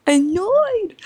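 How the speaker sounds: over-the-top incredulous echo; exaggerated disbelief. Shown as a demonstration. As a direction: squeak "Annoyed." as if it's absurd.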